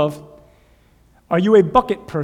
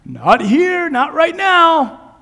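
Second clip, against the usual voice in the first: high, drawn-out sheepish answer; mock reply; 11 semitones higher, 7 dB louder, much slower than usual. Disciples answering Jesus, sheepish and slow. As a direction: stretch it out, pitched up.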